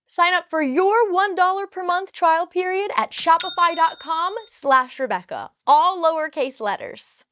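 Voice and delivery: loud, upbeat sponsor read. Acted. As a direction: pitch the sponsor with energy.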